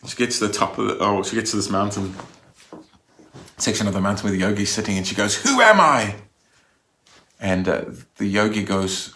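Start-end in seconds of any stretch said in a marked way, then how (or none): 5.4-6.2 high, loud cry sliding downward; acted scream. Seeker confronting a yogi on the mountain, acted scream. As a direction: shout the question up at the yogi. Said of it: "screams out".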